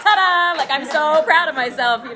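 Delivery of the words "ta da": triumphant ta-da. Real, not acted. Triumphant announcement of sobriety.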